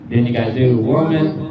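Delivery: doubled voice with echo; two echoing voices at once; dual woman echo. Shown as a demonstration. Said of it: "you got echo modes on each one".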